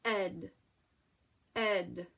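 "Ed" as short e running into d; blended spelling sound. Shown as a demonstration. Teacher saying E and D together, blended sound. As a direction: say "Ed" as one isolated unit. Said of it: "try and say 'em together".

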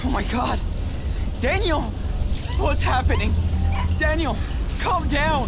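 loud, high, alarmed shouting; panicked calling out. Acted. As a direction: call out in alarm to calm him down. Brother panics as Daniel loses control.

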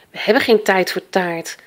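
plain reading voice

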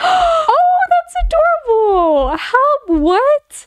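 very high gushing squeal; gasp, then squeal high with delight; delighted amazement. Real, not acted. Amazed on seeing the house.

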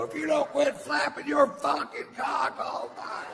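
high, loud yelled insult; furious shout. Retold or imitated. Yelling at the woman talking in the theater, loud high shout. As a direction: scream it at her across the theater. Whole theater hears yell.

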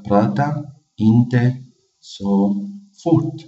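drawn out slowly, syllable by syllable; teacher pronouncing slowly; slow demo. Shown as a demonstration. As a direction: say it slowly for learners. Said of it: "لا تتكلم بسرعة".

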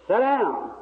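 loud, high mock holler; imitated gruff order. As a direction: shout it like an annoyed bystander. Man in crowd orders him down.

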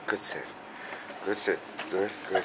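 soft, low praise; calm praise. Real, not acted. Trainer praising dog's sit at doorway, soft and calm. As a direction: quietly hold the dog steady.

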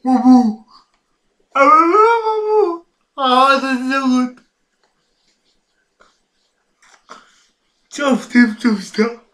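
talking while dancing along; words bounced out mid-dance. Real, not acted. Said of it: "I could stop dancing".

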